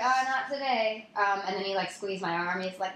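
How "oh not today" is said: high "Oh, not today" stretched a little; flirty drawl. Retold or imitated. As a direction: tease it out coyly. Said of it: "And I was like".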